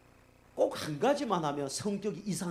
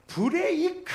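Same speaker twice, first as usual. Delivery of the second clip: driving instructor snapping at the wheel, shouting much higher; frantic shout; 9 dB louder, much slower than usual. Retold or imitated. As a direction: yell it high and loud, losing it. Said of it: "완전히 이성을 돌아갔고".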